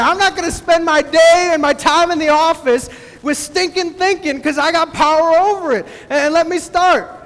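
rally the congregation, voice up and driving; full-force preaching. Acted. Preaches against the devil.

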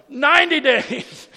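drawn-out, high, very loud yell; mock a judge shouting down the hall; retold judge's bellow. Judge shouts sentence after her.

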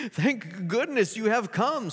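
a governor wringing his hands in relief; relieved governor. Acted. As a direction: governor greeting Gladys, anxious relief. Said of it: "said the governor, wringing his hands".

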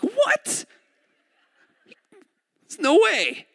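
high, loud squeal of disbelief; shocked disbelief. Retold or imitated. People without Bibles reacting, high and loud in shock. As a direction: gasp it out like you can't believe it. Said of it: "People who don't have Bibles like".

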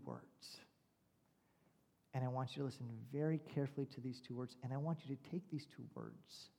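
hushed, low-pitched counsel; calm therapist. Retold or imitated. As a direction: lean in softly as if sharing vital advice. Acted therapist leaning in before giving advice.

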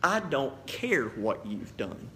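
soft, slow and tender, reassuring the listener; loving tone. Real, not acted. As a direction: say it gently, like forgiving a friend. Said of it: "in the most loving tone I can to you".

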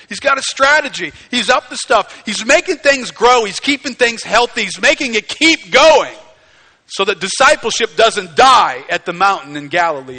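loud, high, driving sermon delivery; full-force preaching. Acted. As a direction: build insistently, line upon line.

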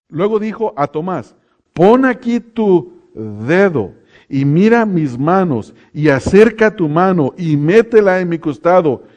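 slow, forceful Bible proclamation; emphatic scripture reading. Acted. Preacher reading Jesus's words to Thomas, emphatic scripture reading. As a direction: proclaim each phrase deliberately and with force.

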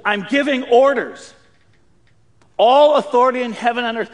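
stern Navy-father order voice, loud at the end; commanding orders tone. Retold or imitated. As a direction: give orders, no discussion. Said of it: "Jesus is taking the tone of voice".

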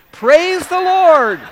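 very high, very loud, slow drawn-out praise; triumphant praise. Acted. Old woman shouting triumph at her neighbor, ecstatic. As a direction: shout it to the sky like a victory.

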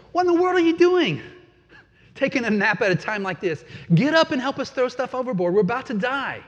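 the captain yelling at Jonah mid-storm, frantic and loud; frantic captain shouting. Acted. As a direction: shake him awake in a panic.

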